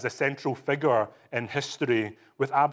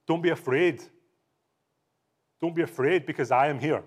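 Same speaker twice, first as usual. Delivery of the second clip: reassuring Jesus; 4 semitones higher, 3 dB louder than usual. Acted. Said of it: "He says to, to John".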